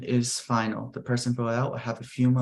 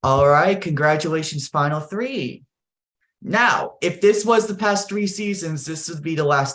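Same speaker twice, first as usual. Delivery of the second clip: higher, louder game show host energy; hype announcer; 6 semitones higher, 3 dB louder than usual. Acted. Host congratulating the final three players, hyped announcer. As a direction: pump up the finalists.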